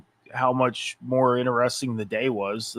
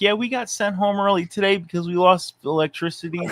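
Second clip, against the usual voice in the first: higher, mock-gleeful schoolkid voice; excited schoolkid; 6 semitones higher than usual. Teasing a co-host about going home early, mock excited schoolkid. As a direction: imitate a kid thrilled by a half day. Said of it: "like you just had, like, a half a day of school".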